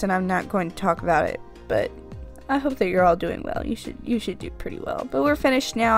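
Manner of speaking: usual speaking voice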